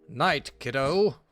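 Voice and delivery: loud, slow, hammed-up dad goodnight. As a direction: boom it out like a cheesy sitcom dad. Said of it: "It's my dad voice".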